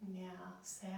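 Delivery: ordinary talk, nothing marked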